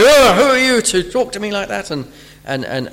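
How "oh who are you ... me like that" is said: proud indignant retort. Retold or imitated. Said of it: "a proud person will rear up and say".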